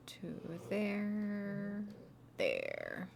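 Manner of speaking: normal talking voice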